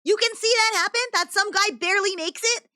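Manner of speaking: high-pitched disbelief, asking over and over; can't believe anyone would still ride it; incredulous. Real, not acted. Co-host asking in disbelief why riders still went on.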